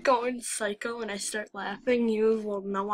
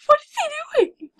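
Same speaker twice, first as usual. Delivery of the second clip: much higher, louder, stressing "What"; squealed repeat; 8 dB louder than usual. Real, not acted. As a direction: squeal "What is he doing?" in disbelief.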